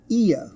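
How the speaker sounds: high, falling "ear", ee sliding to uh; two-sound diphthong. Shown as a demonstration. Teacher models the word, "ear" in two sounds. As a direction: say "ear" as ee-uh, dropping the r. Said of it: "this thing is pronounced".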